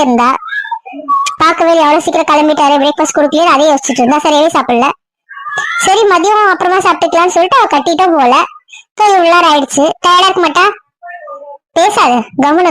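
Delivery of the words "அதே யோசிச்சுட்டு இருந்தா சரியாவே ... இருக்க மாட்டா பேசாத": ordinary delivery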